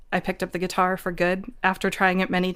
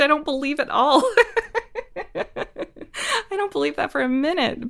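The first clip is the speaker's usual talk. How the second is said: laughing it off, pitched up; 9 semitones higher than usual. Real, not acted. Guest laughing off the old belief to the host, laughing. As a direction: scoff through a real laugh.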